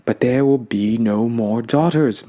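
a slow, lightly voiced grandmother's moan; understated slow lament. A narrator's reading. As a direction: let it sink, slow and defeated. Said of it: "my grandmother moaned".

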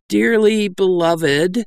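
slow, hesitant high opening; uncertainly. A narrator's reading. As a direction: start the sermon unsure of yourself. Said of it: "Doll said uncertainly".

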